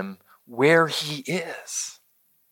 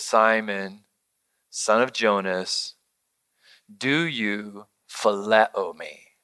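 soft, low, slow and gentle asking; hushed, tender question; tender Jesus; 10 semitones lower, 6 dB quieter, slower than usual. Acted. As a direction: ask it quietly, like a hurt friend. Jesus's third question, tenderly quoted.

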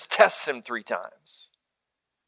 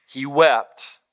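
ordinary talk, nothing marked

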